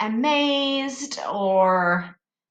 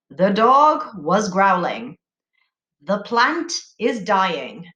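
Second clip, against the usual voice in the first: slow example reading; 3 semitones lower, slower than usual. Shown as a demonstration. Teacher reading example statements slowly to the class. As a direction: read slowly and clearly, like an example on the board. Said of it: "a statement is a sentence that tells".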